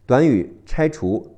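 usual speaking voice

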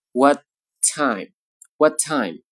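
what time with the t held once; what time with unreleased t; lost plosive. Shown as a demonstration. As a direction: merge the two t's in what time. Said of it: "前面爆破音呢就要失去爆破".

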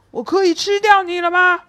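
lion gloats to Minus he can eat him now, smug. Acted. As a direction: savour asking if he may eat 我可以吃掉你了吗 at last. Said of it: "狮子得意地对米努斯说".

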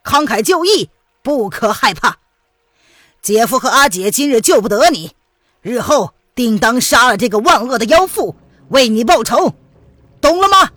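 loud, urgent exhortation to be brave; resolute avenging relative. Acted. Relative vowing revenge to a doomed sister, loud and resolute.

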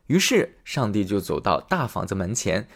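plain reading voice

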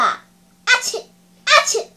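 loud, high "achoo" bursts; explosive mock sneezes; two acted sneezes. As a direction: sneeze hard, twice over. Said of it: "又打喷嚏了".

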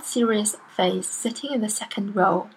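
ordinary delivery

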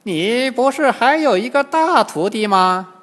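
put-on high, loud old demon voice; old demon questioning. A narrator's reading. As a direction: probe the prisoner as an old demon. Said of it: "金蝉老妖又问唐僧". (老妖高声追问)

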